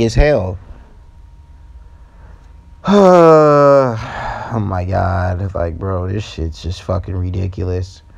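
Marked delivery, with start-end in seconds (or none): 2.8-4.5 long, loud falling sigh; exasperated sigh. Real, not acted. Worn down by repeated game failures. 4.5-8.0 low, soft, defeated grumble; fed-up resignation. Real, not acted. Fed up after the big sigh.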